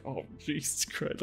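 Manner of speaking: quiet slow mutter. Retold or imitated. Fork hits friend's head.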